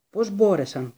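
Hermione, horrified, chokes out the words; choked. A narrator's reading. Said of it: "πρόφερε πνιχτά".

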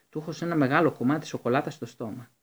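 usual speaking voice

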